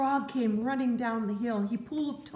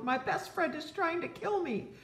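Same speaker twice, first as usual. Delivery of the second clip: mock alarm; 8 semitones higher than usual. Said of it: "cried Toad".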